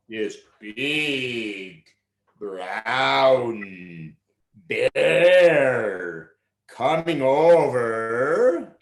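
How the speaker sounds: low, slow, dramatic build; ominous storytelling. A narrator's reading. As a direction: draw out the danger slowly. Narrator builds menace.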